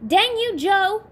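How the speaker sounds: loud, high angry yell; screaming. Acted. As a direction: shriek it at his brother. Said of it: "Eddie screams".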